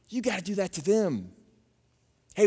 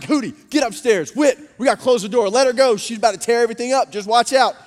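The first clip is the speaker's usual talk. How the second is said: loud, high, rushed warnings; urgent re-enacted evacuation, raised voice; mock alarm; 3 semitones higher, 7 dB louder than usual. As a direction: clear the house in a comic panic. Mock panic, evacuating dog and wife.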